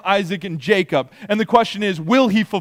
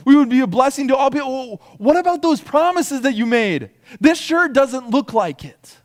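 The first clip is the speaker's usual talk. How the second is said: raised, high pleading cry to God; groaning out; 7 semitones higher, 5 dB louder than usual. Acted. Enslaved Israelites crying out to God, high anguished plea. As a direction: cry out loudly in protest. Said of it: "And they're groaning out".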